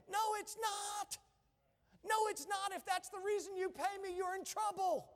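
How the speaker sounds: high-pitched, emphatic preacher's rebuttal; indignant retort. Real, not acted. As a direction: fire back indignantly at the congregation. Indignant retort to imagined congregation.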